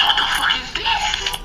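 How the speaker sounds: very high, drawn-out squeal; flabbergasted. Acted. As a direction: squeal in mock astonishment. Naruto acted seeing house.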